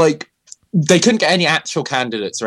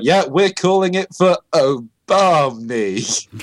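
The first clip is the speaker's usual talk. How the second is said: mock anchor; 4 semitones higher, slower than usual. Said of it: "It's like".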